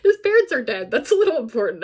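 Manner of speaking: high, loud self-mocking blurt; loud realization. Real, not acted. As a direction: burst out at your own forgotten detail. Blurts out a forgotten key detail.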